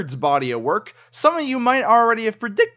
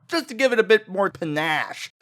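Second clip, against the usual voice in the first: ordinary talk, nothing marked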